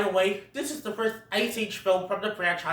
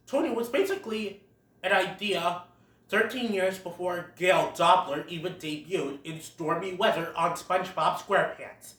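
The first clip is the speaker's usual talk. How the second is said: usual speaking voice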